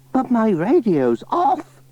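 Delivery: put-on high old lady voice; chatty old granny, pitched high. Granny Dryden asking Pat about the spade, high old voice.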